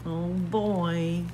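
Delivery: usual speaking voice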